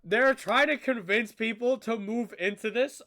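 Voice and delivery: loud, indignant question; outraged disbelief. Real, not acted. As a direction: call out the absurd plan. Outraged reaction to the video.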